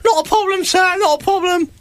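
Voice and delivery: very high eager urchin squeak; street urchins. Acted. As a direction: answer like an obliging little child. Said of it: "That's what they did".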